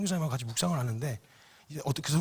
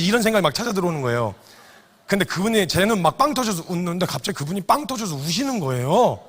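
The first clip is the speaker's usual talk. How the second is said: ordinary talk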